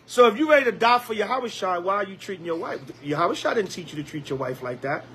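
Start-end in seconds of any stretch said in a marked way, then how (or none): none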